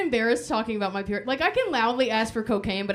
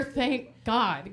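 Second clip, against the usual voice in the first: ordinary delivery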